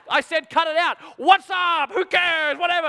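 high, loud mock exasperation; exasperated dad. Dad giving up on his fighting sons, mock exasperated. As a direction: throw up your hands, done with them.

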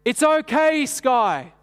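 high, loud call across a hall; hammed-up shout of reassurance; mock calling out. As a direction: call out cheerfully from far away. Leader calls across the hall.

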